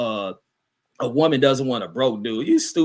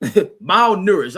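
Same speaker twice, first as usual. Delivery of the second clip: laughing correction; 6 semitones higher, 6 dB louder, much slower than usual. Real, not acted. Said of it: "I said malnutritious".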